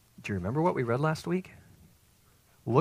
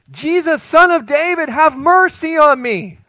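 loud, high desperate cry, mercy drawn out slowly; 14 dB louder, slower than usual. Acted. Blind beggar crying to Jesus, loud and desperate. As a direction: call out over the crowd with everything you have. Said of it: "He cried out all the more".

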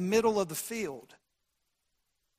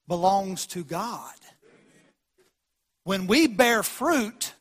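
ordinary talk, nothing marked

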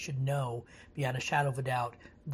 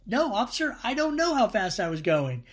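lightly acted driver pleading ignorance, higher and louder; driver answering officer; 7 semitones higher, 6 dB louder than usual. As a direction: play a mildly protesting motorist. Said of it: "And you can say".

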